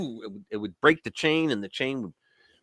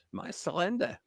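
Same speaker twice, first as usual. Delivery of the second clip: laughing; 3 semitones higher, 6 dB quieter than usual. Real, not acted.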